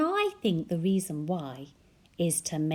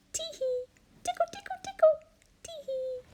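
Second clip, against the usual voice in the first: high tickle; faster than usual. Acted. Octopus tickling children.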